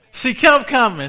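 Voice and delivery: shouted high and loud; preacher's ringing shout; loud preaching cry. Shown as a demonstration. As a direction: hit it hard for the church.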